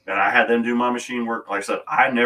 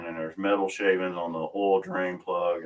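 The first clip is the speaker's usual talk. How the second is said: usual speaking voice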